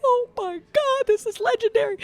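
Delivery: hammed-up starstruck gasping delight; awestruck squeal. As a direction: draw it out high, overwhelmed with awe. Retold starstruck reaction.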